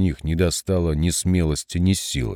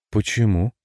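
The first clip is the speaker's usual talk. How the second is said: genuinely curious; 2 semitones higher than usual. A narrator's reading. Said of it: "с неподдельным интересом спросила девица".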